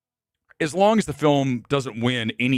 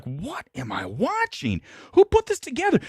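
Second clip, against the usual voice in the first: mock disbelief; 12 semitones higher than usual. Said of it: "you're like".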